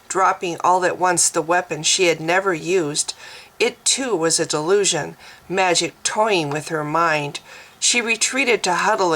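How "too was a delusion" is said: usual speaking voice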